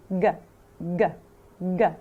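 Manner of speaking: ج as a hard English g; teacher drilling the g sound; hard g demo. Shown as a demonstration. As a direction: click out a hard g. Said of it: "لكن نطقه".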